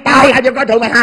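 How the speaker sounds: fast, high, shouted challenge; angry retort. Acted. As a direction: fire it back at full volume. Shouts back in the quarrel. (quát lại giận dữ)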